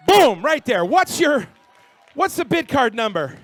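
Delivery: loud, high excited call; excited shout. Real, not acted. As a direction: hype the crowd. Excited sale of second set.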